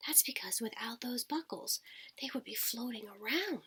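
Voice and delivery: soft whisper so as not to wake anyone. Acted. Telling kids about sleeping astronauts, hushed whisper. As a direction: whisper it like the astronauts are asleep.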